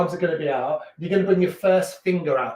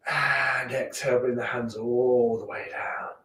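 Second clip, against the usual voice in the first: guided breathing; 3 semitones lower than usual. Acted. Slow guided arm breathing.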